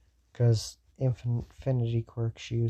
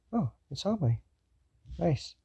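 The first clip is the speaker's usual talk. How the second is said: higher, casually pleased reaction; chill; 6 semitones higher than usual. Acted. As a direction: react chill but not cold. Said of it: "He's chill, not ice cold hearted".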